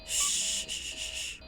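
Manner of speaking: breathy unvoiced shushing; whispered shush. Real, not acted. Streamer hushing herself while hiding from the monster, whispered shush. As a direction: hush yourself, barely a breath.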